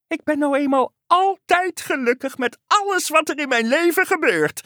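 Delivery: high, gleeful frog voice; ever-happy frog. A narrator's reading. Frog telling friends it is always happy, gleeful.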